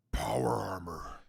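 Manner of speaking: hushed unvoiced whisper. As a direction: breathe it out in awe. Awed low aside.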